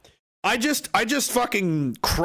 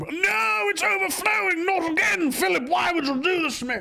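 high mock plea. Pleading with Philip over an overflow, high mock wail.

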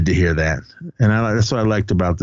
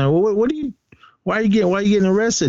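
much higher, stumbling questions; retold bewilderment; 11 semitones higher, much faster than usual. Kids to their arrested principal, bewildered. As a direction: imitate baffled kids, pitched way up.

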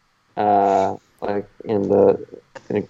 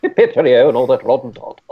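put-on jokey English accent, a bit raised; mock British accent. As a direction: chirp it like a cartoon English toff. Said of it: "speaking in an English accent".

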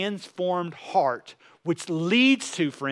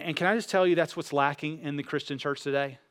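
ordinary talk

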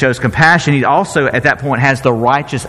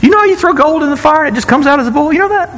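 sheepish Aaron; 12 semitones higher, much faster than usual. Retold or imitated.

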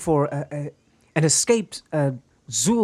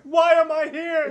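high, slow wailing laughing whine; Ubiquitous; slower than usual. Retold or imitated. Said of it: "You can just hear Ubiquitous at the top of the tree".

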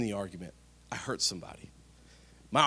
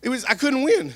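much higher, louder comic complaint; mock exasperation; 11 semitones higher, 9 dB louder than usual. Real, not acted. Preacher joking to congregation, high exasperated complaint. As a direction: throw up your hands in mock despair. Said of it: "mock exasperation".

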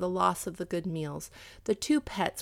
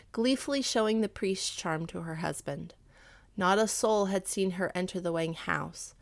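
ordinary delivery

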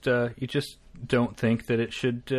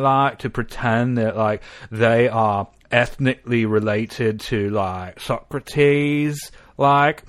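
stoned Australian student; 2 semitones higher, much slower than usual. Retold or imitated. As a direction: drag out the words, drifting through filler. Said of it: "That's how she talked".

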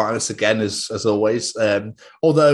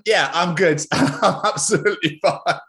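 ordinary talk

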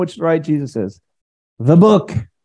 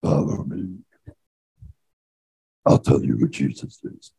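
much lower, softer sinister growl; Freddy Krueger devil; 13 semitones lower, 6 dB quieter than usual. Acted. Preacher mimics the devil as Freddy Krueger, low menacing growl. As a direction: creep them out like a horror villain. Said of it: "like Freddy Krueger".

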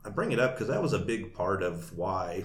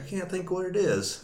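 mock puzzled lady; 11 semitones higher, 3 dB louder than usual. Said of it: "she's like".

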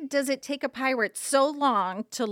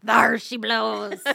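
pirate voice; 9 dB louder, slower than usual. Pretend. Pirate speak.

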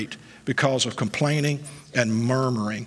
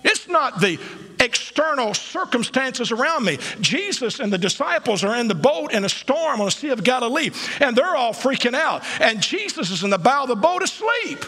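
full-force preaching; 10 semitones higher than usual. Acted. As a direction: preach it to the rafters with rising fire. Preaching the storm story.

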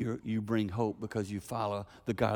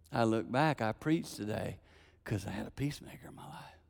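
softer, tender, fading to a whisper; hushed inner thought; 3 semitones higher, 5 dB quieter than usual. Retold or imitated. Boy on the swing feeling safe, hushed to a whisper. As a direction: let the remembered thought sink to a whisper. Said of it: "I remember thinking".

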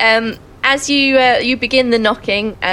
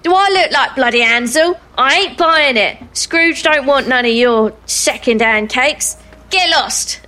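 angry guard; 4 semitones higher than usual. Acted. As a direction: throw the cake back at them. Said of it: "the guard takes in the ... and says".

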